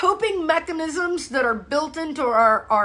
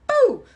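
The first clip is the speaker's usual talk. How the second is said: mock boo; 13 semitones higher, 5 dB louder than usual. Mimicking hormones hitting the body.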